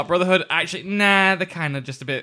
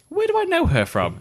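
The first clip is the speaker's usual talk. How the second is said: puzzled; 12 semitones higher than usual. Retold or imitated. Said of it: "I went".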